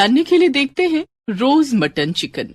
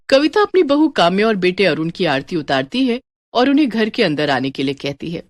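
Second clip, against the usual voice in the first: ordinary delivery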